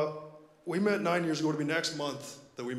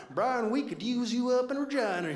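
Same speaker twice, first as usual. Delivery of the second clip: put-on thick Southern accent; 10 semitones higher, 6 dB louder than usual. Boss calling with a job offer in a Southern accent. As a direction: toss off a Southern boss's voice, laughing. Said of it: "He's got a really thick Southern accent".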